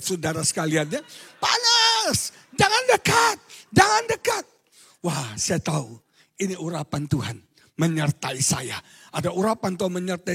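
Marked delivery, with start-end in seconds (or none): 1.4-4.4 very high, louder demon shout; possessed shriek. Retold or imitated. Possessed youth screaming again, very high and loud. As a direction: scream as the burning spirit. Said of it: "Saya kan bukan microwave".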